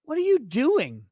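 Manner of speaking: a hint of a worried wife; woman asking. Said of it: "she says".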